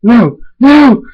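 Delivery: high, loud cries, rising; escalating cries of defeat; panicked shouts. Acted. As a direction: protest in mounting desperation. Villain being defeated.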